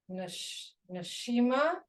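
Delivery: ordinary talk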